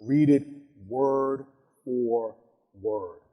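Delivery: slow, fading word for word; slow and quietening; slow reading. Shown as a demonstration. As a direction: space the words out slowly. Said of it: "I like to read it slowly".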